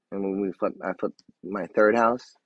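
normal talking voice

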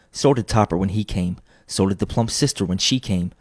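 usual speaking voice

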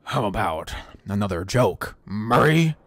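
low, drawn-out villain line, name punched; menacing clown villain with a hard finish; Heath Ledger's Joker. Acted. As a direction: ask it creepily, overdo the last word. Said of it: "it's too much with Murray".

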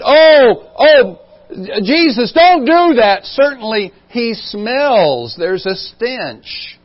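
high, loud pleading cry that slows toward the end; pleading sisters. Acted. Mary and Martha beg Jesus at the tomb, high loud pleading. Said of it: "When Mary and Martha said".

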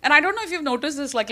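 ordinary talk, nothing marked

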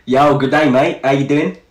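casual mock Aussie greeting; Australian accent. Introducing himself to a viewer in a put-on Australian accent. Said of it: "What about a different accent?".

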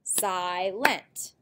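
"Silent" split into two beats, si-lent; syllable by syllable. Shown as a demonstration. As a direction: break "Silent" after the long I. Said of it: "Silent has two syllables".

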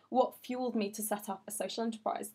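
usual speaking voice

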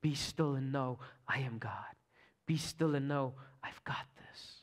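hushed tender Holy Spirit voice; soft still whisper. Retold or imitated. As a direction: breathe it like a gentle inner whisper. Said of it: "the soft, still whisper of the Holy Spirit".

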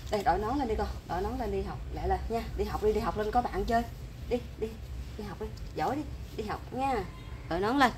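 mother softly coaxing son to school. Real, not acted. Mother gently coaxing child to school.

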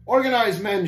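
high, loud rallying order; captain's shout. A narrator's reading. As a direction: shout to rally scattering men. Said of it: "shouted the captain".

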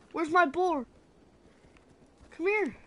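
normal talking voice

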